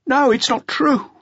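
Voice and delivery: high, strained, hoarse denial; hoarse cry. A narrator's reading. Jean denying the charge to the inspector, hoarse cry. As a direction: cry it out hoarsely, near breaking. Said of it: "Jean cried hoarsely".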